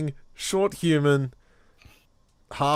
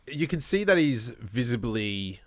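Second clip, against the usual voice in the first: usual speaking voice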